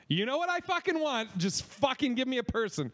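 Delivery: high-pitched fed-up demand; exasperated caller. Retold or imitated. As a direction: plead angrily with a phone menu. Acted caller fed up with menus.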